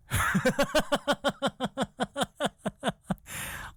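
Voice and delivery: real high-pitched laugh in a run of pulses; long laugh. Real, not acted. Comic cracking up at his Floridian punchline, laughing.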